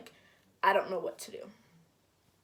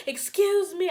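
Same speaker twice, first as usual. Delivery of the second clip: high, louder call after him; yelling excuse me; 9 semitones higher, 5 dB louder than usual. Retold or imitated. Chasing the boyfriend down the hallway, a high yell.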